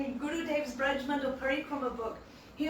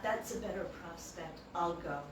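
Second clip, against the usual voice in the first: softer, lower inner thought; quiet musing; 6 semitones lower, 8 dB quieter than usual. Retold or imitated. Krishna deciding to surrender, musing quietly. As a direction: muse to yourself. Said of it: "So he thought".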